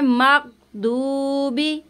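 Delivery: very slow, मगदूबी with ghain held in the throat; don't turn ग़ into ग in मगदूबी. Shown as a demonstration. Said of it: "मग नहीं कीजिएगा". (मगदूबी में ग़ैन हलक़ से)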